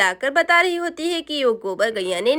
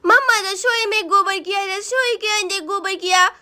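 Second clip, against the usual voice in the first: put-on tattling little boy; 3 semitones higher than usual. Boy tells mamma about the cow dung, childish tattling. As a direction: lisp it like an excited small child.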